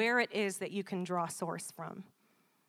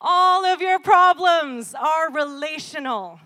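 loud and higher, slowed down; emphatic preaching; 7 semitones higher, 14 dB louder, slower than usual. Real, not acted. Preacher restating his point to the congregation, loud and slow. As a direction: hammer the point.

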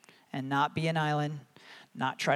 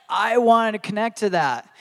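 loud, raised-pitch eagerness; eager resolve; 6 semitones higher, 10 dB louder than usual. Retold or imitated. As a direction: push it out with keen enthusiasm. Acting out eagerness to share joy.

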